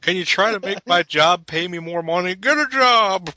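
high shouted punchline; mocking old politician. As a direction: bark the punchline like a clueless old man. Mock dialogue, punchline shouted.

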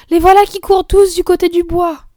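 put-on loud, high girlish alarm; excited cry. Girl shouting as hedgehogs run to the woods, loud. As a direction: point and shout after them.

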